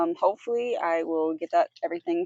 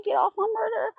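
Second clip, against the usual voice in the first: outraged; 13 semitones higher than usual. Real, not acted. Outraged at the injustice.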